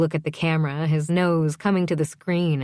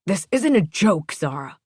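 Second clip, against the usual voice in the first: raised scolding, dropping on the name; stern father; 3 semitones higher, 3 dB louder than usual. A narrator's reading.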